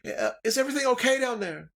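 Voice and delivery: high, fast little-woman voice; a small woman calling from her porch, high and quick; halfling woman. Acted. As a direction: peek out and call down in a squeaky voice. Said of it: "And she's like".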